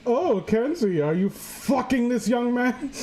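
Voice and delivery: mock prim mom. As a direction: ask it like a prim shocked mother. Mom voiced asking crude question.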